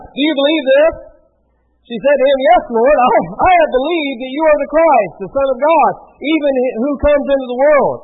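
projected, emphatic Bible reading; scripture reading. A narrator's reading. Preacher reads Jesus promising life to Martha, projected scripture reading. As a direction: declaim the passage to the room.

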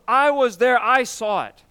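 I was there shouted with conviction; emphatic witness. Retold or imitated. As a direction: proclaim it as an eyewitness who insists. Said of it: "John wants you to know".

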